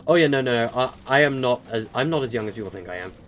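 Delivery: ordinary delivery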